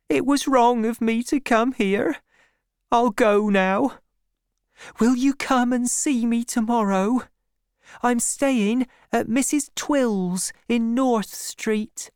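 put-on high, meek girl's voice; Fanny Robin. A narrator's reading. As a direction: contrite young woman's high voice.

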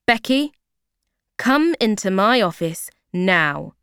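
loud, stern summons; angry manager ordering Becky to her office; angry boss. Acted. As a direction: call her in, sharply. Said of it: "She looks very angry".

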